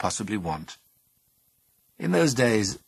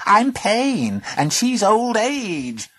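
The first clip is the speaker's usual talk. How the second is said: creature voice; 13 semitones higher, 8 dB louder, much slower than usual. Acted. Creature announces itself.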